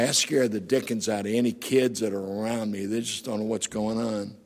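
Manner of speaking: normal talking voice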